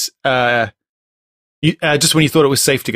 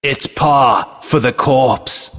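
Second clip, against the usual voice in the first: slow, dramatic trailer read; movie trailer voice; much slower than usual. Acted. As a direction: announce it like a film trailer. Reads tagline as movie trailer.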